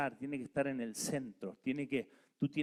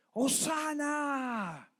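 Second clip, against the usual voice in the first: "¡Hosana!" gritado largo y muy agudo; grito de hosana; 12 semitones higher, 4 dB louder, much slower than usual. Retold or imitated. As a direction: clama como la multitud, estirando la palabra. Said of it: "clamaba a los gritos". (grito alargado y agudo de alabanza)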